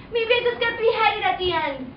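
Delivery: ordinary talk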